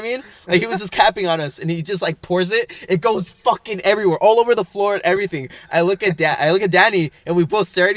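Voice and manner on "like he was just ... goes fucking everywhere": usual speaking voice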